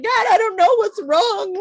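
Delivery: high, loud, hammed-up wail to God; mock despairing cry. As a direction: cry out as if at wit's end, half-playing.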